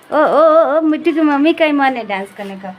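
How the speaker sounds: loud, fast playful tease; playful loud teasing. Real, not acted. Mother teasing Mittu's mom, loud and playful. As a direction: tease her loudly and quickly.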